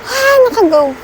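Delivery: loud shivering cry of cold; freezing, voice jumping up. Real, not acted. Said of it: "Ang lamig".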